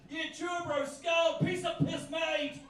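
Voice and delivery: rugby-loving bogan. Acted. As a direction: yell it like a hard-drinking rugby lad. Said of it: "the hard drinking chisel jaw rug-, rugby loving bogan".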